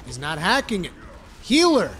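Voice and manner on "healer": high, loud hype yell; loud demanding yell; shouting. Real, not acted. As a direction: bellow for heals like a raid cheer. Yelling for heals mid-dungeon.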